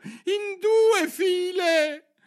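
very high, loud, drawn-out shout; shouted cry. A narrator's reading. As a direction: shriek the order slowly like a drill caller. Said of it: "gridò la tartaruga d'Egitto". (grido acuto e prolungato)

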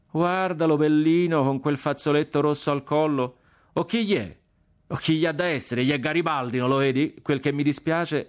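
high-pitched mocking Florentine banter; working-class Florentine accent. A narrator's reading. Boys poke fun at the partisan officer, working-class Florentine accent. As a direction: tease in a Florentine street accent, voice lifted. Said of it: "Parlavano con l'accento popolano di San Frediano".

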